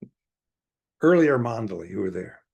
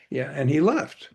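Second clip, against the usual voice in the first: normal talking voice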